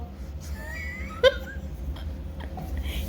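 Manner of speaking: squealing high laugh; high-pitched laugh. Real, not acted. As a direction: burst into a shrill giggle. Laughs at Paco joke.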